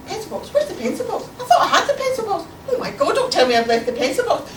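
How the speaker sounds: high, hurried fretting; acted frantic muttering to oneself; flustered panic. Frantic search for lost pencil box.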